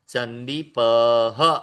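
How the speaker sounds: "संदीपः" slow, ending in an aspirated "अह"; visarga breathed out. Shown as a demonstration. Teacher showing the class the breathed visarga in "संदीपः". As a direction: draw out "संदीपः" and breathe the final "अह". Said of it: "अह".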